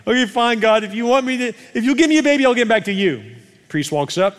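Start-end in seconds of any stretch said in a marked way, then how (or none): 0.1-3.2 high, loud concession; acted giving in, worked up; exasperated bargaining. Preacher as Hannah bargaining with God, exasperated high voice.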